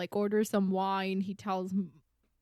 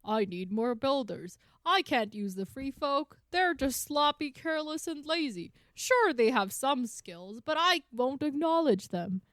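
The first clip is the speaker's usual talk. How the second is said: higher-pitched, put-upon grumbling; mock complaining; 5 semitones higher than usual. Host imitating two builders griping to John, mock complaining. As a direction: list the grievances in a raised mock-complainer voice. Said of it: "complaining yet again".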